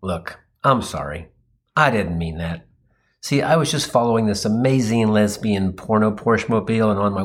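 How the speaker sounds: low, deflated apologetic explanation; sighing apology. Acted. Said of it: "I sighed, took a deep breath, and said".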